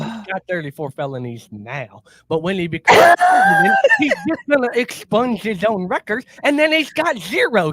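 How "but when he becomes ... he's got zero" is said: mock excited pitch. Mock pro-Trump argument.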